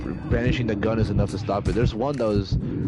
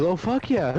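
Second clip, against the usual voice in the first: put-on shocked kid; 9 semitones higher, slower than usual. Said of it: "then he goes".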